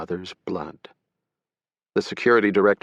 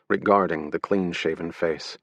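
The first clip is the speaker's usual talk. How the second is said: plain reading voice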